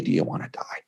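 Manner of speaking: soft, whispered blunt question; a quiet, deadly serious whisper; hushed mom cutting through; stern hushed mother. Retold or imitated. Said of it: "she says back to me".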